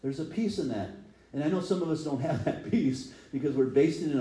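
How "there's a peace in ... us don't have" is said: ordinary talk, nothing marked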